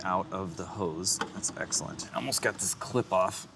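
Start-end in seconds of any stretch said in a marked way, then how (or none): 1.3-2.1 whispered. Real, not acted. Pleased aside under breath.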